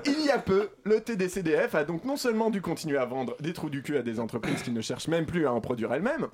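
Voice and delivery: ordinary talk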